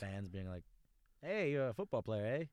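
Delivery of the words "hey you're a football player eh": lightly put-on Canadian accent, a playful "eh?" at the end. Playful Canadian accent, teasing a football player. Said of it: "is that my accent".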